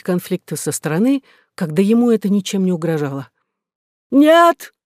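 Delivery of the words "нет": sharp high shout of refusal. A narrator's reading. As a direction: yell it out. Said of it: "крикнул министр".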